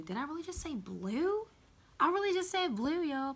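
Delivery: high, incredulous rise at own slip; amused disbelief. Real, not acted. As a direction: catch yourself in surprise, pitch swooping up. Catches own slip of tongue.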